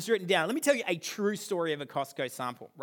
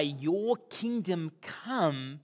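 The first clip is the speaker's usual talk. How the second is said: prayerful recital; much slower than usual. Acted. Quoting the prayer.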